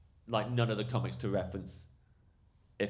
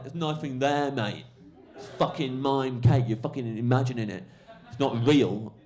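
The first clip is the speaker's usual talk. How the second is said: raised, louder mock exasperation; retold furious heckler; 5 semitones higher, 7 dB louder than usual. Heckler refusing the comic's mime cake, loud mock fury. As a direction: act out an exasperated audience man. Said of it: "The guy's fucking furious".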